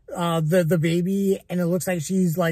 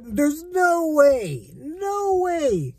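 high, drawn-out incredulous refusal; emphatic disbelief; 7 semitones higher, much slower than usual. Real, not acted. Reviewer insisting the character would stand up for herself, emphatic disbelief. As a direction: dismiss it in a high, stretched-out voice. Rejecting the film's implausible plot.

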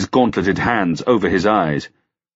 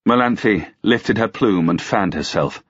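ordinary delivery, nothing marked

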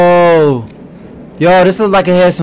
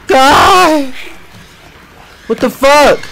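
startled cry; 9 semitones higher, much slower than usual. Real, not acted. Startled by a monster.

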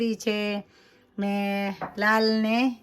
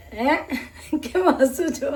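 higher, laughing question; 4 semitones higher than usual. Real, not acted. Daughter asks why he is laughing, laughing herself. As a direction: ask it through a laugh.

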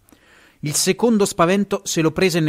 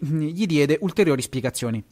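ordinary delivery

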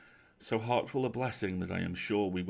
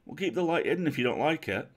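raised voice hailing a boat; quick, loud call to someone out on the water; urgent shout to coax them back; 5 semitones higher, 6 dB louder, faster than usual. Acted. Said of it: "sang out Will".

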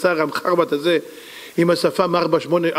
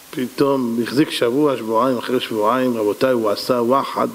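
ordinary talk, nothing marked

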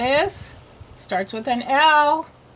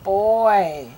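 ordinary talk, nothing marked

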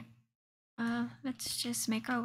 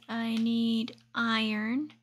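ordinary talk, nothing marked

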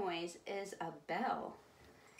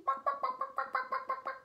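high, rapid string of clucks; hen clucking; much faster than usual. Pretend. Host finishing the hen rhyme, high rapid clucking. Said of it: "I love that bark".

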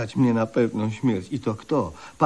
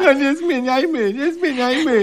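put-on much higher, sulky child voice; whiny falsetto. [name] refusing to change the subject, high whiny sulk. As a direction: whine like a pouting kid.

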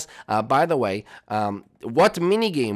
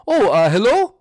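startled Mario voice; 8 semitones higher, 8 dB louder than usual. Acted. Mario answering the door.

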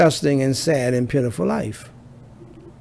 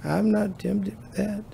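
higher-pitched mimicry of a pious relative; mock someone claiming innocence in a higher voice; imitating family member; 7 semitones higher than usual. Relative denying any temptation to the family, mimicked. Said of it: "family member that says".

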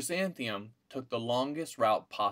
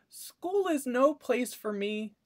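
put-on high, slow, sad little girl; a small girl's glum complaint, high and slow; 13 semitones higher, 4 dB louder, slower than usual. A narrator's reading. Chrysanthemum complaining to parents, high and slow. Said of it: "said Chrysanthemum".

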